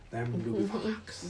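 slow, quiet pirate drawl; a light hint of a pirate captain; Barbosa voice. Shown as a demonstration. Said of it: "I'm Barbosa".

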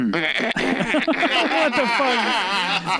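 drawn-out high disbelief; stunned. Real, not acted. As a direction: stretch it out, baffled. Laughing disbelief after story.

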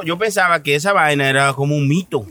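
ordinary talk

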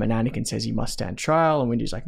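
ordinary talk

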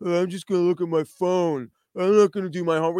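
put-on sulky teen who won't do homework; pissed teenager. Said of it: "have that pissed".

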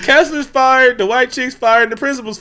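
high, loud, laughing exclaim; cracking up while yelling it out; laughing outburst. Real, not acted. Laughing at the firings, high and loud.

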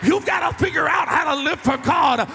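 loud, rising pulpit preaching; fiery sermon voice, slowing on the last lines; full-force preaching. Acted. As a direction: preach it to the back row.

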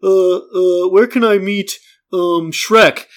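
mock dopey normie; dopey tourist. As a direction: play a clueless dad at the park. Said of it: "this guy's a normie, and he's like".

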